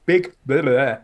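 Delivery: normal talking voice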